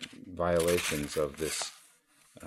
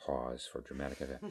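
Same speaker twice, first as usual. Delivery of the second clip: lower, softer, quick throwaway aside; dry aside; 3 semitones lower, 9 dB quieter, faster than usual. Real, not acted. Teacher to the audience before Roshi's answer, dry aside. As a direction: drop it under the punchline, soft and quick. Wry aside amid an anecdote.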